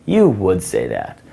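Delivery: slow, knowing teasing line; sassy tease. Shown as a demonstration. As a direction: drag it out like you've caught her. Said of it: "another one is".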